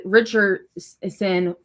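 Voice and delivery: Richardson stretched out very slowly; drawn-out emphasis. Real, not acted. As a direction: savor the name Richardson, slowly. Repeats name, drawn out for emphasis.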